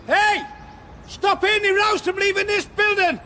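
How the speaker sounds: high-pitched yell across the building; urgent shout. Acted. Manager calling staff to stop the departing agent, urgent shout. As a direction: bellow orders to whoever can hear.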